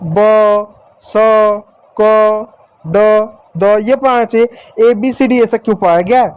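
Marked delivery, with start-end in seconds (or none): 0.0-3.8 ब, स, क, ड, द each said as a short clipped sound; teacher listing letter sounds. Shown as a demonstration. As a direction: tick off ब, स, क, ड, द one by one. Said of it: "पढ़ाना चाहिए बचपन से अ".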